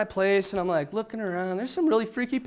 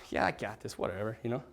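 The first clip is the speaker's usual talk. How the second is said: much softer and lower, shrugging it off; careless teen; 12 semitones lower, 11 dB quieter than usual. Retold or imitated. To the worried priests, a soft careless shrug. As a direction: brush off the priests like a cocky eighteen-year-old. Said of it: "Just an idiot, hat on backwards".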